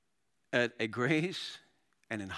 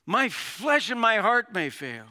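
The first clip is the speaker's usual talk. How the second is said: loud, high, slow declaration; passionate; 9 semitones higher, 7 dB louder, slower than usual. Acted. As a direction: cry it out with drawn-out fervour. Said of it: "Look at that passion".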